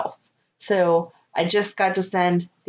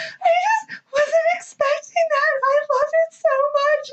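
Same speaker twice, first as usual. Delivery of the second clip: much higher, mock sobbing child; imitating happy sobbing. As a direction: imitate a little girl crying with joy. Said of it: "she starts crying her little eyes out".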